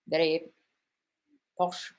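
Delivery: slow, lightly posh lecturer voice; bad posh impersonation. Coach showing how the lecturer sounds, bad posh impersonation. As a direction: attempt a posh voice, badly. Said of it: "I was doing a really bad impersonation".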